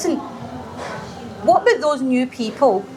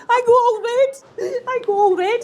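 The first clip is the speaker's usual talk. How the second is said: high squeal through giggles; embarrassed, high-pitched laughter in the words; helpless laughing; 12 semitones higher, slower than usual. Real, not acted. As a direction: confess it while laughing helplessly. Laughing while confessing she blushes.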